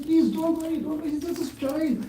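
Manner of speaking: high, gentle reassurance from a holy man; reassuring sadhu. Acted. Sadhu calming the sorry father, high and soothing.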